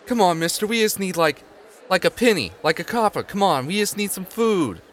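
put-on high, begging street kid voice; pleading urchin. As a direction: whine for a penny like a hungry kid. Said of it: "you hear 'em go".

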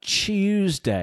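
high-pitched, drawn-out British-accent mimicry; mock British accent. Second host echoing the reporter's accent, mock British. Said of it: "muddled British accent".

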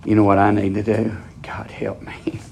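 hushed, breathy whisper to the crowd; sudden confiding whisper. Acted. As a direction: lean in and whisper it. Preacher drops to a whisper.

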